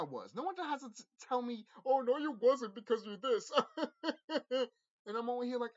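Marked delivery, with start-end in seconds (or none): 1.9-3.5 high, loud mock objection; mocking objector. Speaker parroting doubters who deny what they saw, mocking. 3.6-4.7 high, loud burst of laughter; real laugh bursting out. Real, not acted. Speaker laughing off those who doubt them, amused laughter.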